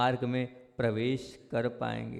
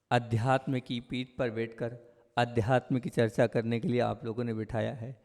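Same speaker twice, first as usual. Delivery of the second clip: ordinary talk, nothing marked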